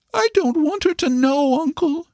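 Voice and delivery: put-on very high, anxious child's voice; pleading boy. A narrator's reading. Boy begs his uncle to keep it secret, high and anxious. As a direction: beg like a worried child. Paul voiced in the read story.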